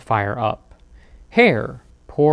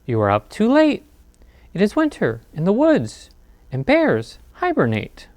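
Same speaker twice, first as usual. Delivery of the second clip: a hint of a high mouse voice; gently fussing, a bit higher; lightly squeaky mouse; 6 semitones higher than usual. Mouse scolding Bear for being up late, lightly squeaky. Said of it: "the mouse squeaks".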